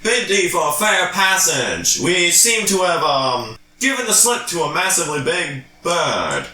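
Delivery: high, hammed-up formal plea; courtly character voice. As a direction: petition the guard with mock courtesy. Player pleads in character with guard.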